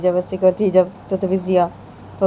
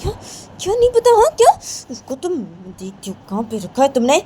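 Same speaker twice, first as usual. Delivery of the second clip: scolding grandma; 7 semitones higher, 4 dB louder than usual. Acted.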